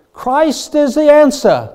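loud, high, drawn-out North Carolina preacher; Billy Graham. Retold or imitated. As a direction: ring it out like a crusade evangelist with a North Carolina accent. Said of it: "in his great North Carolina accent".

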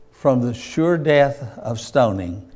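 ordinary talk, nothing marked

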